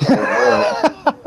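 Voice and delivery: high drawn-out disbelieving where; incredulous where. Retold or imitated. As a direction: sound amazed he hasn't heard. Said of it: "I go".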